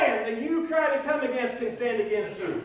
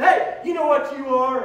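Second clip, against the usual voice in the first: excited call; 3 semitones higher, 6 dB louder than usual. Acted. God calls out excitedly.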